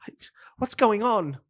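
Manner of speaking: high breathy whisper; panicked whisper. Acted. GM voices panicked NPC on comms.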